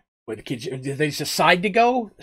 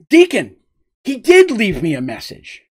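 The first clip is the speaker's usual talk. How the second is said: excited; 5 semitones higher, 5 dB louder than usual. Acted. Petal smiling excitedly.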